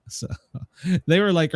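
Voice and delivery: ordinary talk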